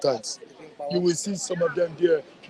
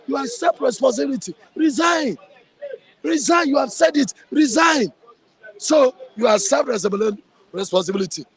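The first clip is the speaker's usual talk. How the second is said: furious demand; 7 semitones higher than usual. Real, not acted. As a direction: hammer the word Resign at him like a verdict. Angry tirade at the president.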